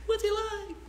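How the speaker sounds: a mock mom shrieking with delight; gushing mom. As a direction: play the thrilled mother hearing her daughter met a doctor. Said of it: "she's gonna go".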